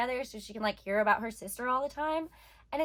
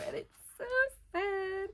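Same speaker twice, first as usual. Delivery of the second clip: tearful, choked up; 6 semitones higher, 4 dB quieter than usual. Real, not acted. Moved by the love confession.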